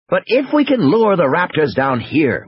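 animated scientist making his case; Wu reasoning urgently. Acted. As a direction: lay out the plan with urgency.

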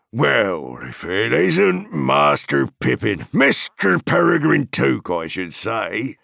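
high, loud, drawn-out farmer's greeting; cried in pleased recognition. A narrator's reading. Farmer recognising young Master Pippin, glad loud cry. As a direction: cry out as the scowl turns to a grin. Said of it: "he cried, changing from a scowl to a grin".

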